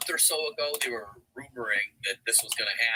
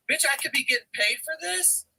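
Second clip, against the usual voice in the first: shocked, much higher pitch; incredulous, high-pitched; 14 semitones higher than usual. Retold or imitated. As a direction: squeal it in disbelief. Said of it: "I'm like".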